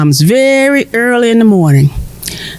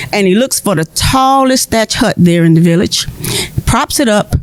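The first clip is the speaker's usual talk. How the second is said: ordinary delivery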